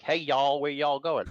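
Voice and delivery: a light Southern drawl on y'all; lightly mock the Southern accent. Lightly mocking a Southern accent to show US accents. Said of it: "Then we have the southern accent".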